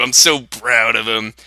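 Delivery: ordinary talk, nothing marked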